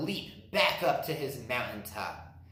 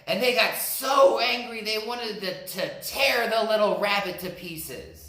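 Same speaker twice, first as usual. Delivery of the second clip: louder, higher dramatic narration; rising excitement; 6 semitones higher, 5 dB louder than usual. A narrator's reading. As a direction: build up the anger in the telling. Narrator builds the animals' anger.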